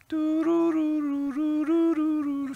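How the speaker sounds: high, slow eerie hum like a horror film tune; spooky hum. Pretend. Preacher humming a horror film tune for effect, eerie. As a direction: hum the scary movie music. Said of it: "باید آهنگمو بزنم".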